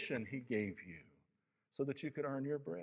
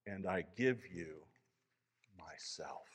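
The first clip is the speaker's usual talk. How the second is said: dropping to a soft, slow, lower close; tender gift of self; 5 semitones lower, 5 dB quieter, slower than usual. Acted. Aslan offers himself to the creatures, softer and slower. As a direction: let the last word fall almost to nothing. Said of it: "strong, happy voice of Aslan".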